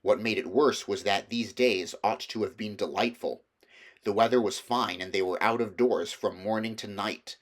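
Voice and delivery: usual speaking voice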